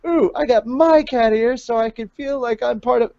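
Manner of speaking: high, put-on gushing fan voice; girly fan voice. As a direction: gush it out like an excited fan. Reading a comment in a voice.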